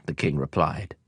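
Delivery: plain reading voice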